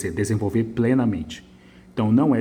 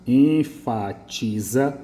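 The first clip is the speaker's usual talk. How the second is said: "enfatiza" stretched very slowly; slow read-out; much slower than usual. Shown as a demonstration. Teacher savouring a good word in the essay, slow. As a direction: linger on "enfatiza" approvingly. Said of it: "gostei disso aqui".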